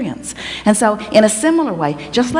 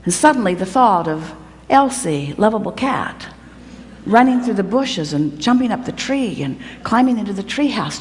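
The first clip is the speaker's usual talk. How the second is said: ordinary talk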